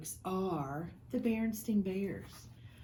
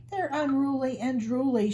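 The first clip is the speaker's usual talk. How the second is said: higher, dramatic motherly complaint; a theatrical mama's objection; 5 semitones higher, 5 dB louder than usual. Acted. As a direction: ham up the disgust. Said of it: "she added dramatically".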